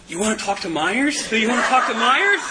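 high-pitched, hammed-up double-check; incredulous repeated question. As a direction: play the friend's astonishment, building on the repeat. Friend reacts to choice of Myers.

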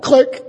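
a high clicked mouth sound for a hung-up phone. Retold or imitated. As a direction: make the phone go dead with a sharp high click. Imitates phone hanging up.

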